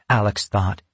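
plain reading voice

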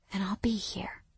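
soft drowsy whisper. Acted. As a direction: whisper it as you drift off. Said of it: "I whispered".

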